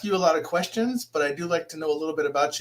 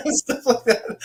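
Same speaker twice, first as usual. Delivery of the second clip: laughing; 5 semitones higher, 5 dB louder, slower than usual. Real, not acted. Laughing at own bad meditating.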